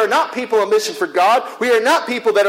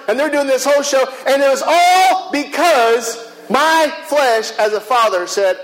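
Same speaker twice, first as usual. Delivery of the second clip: usual speaking voice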